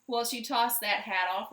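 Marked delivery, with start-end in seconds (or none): none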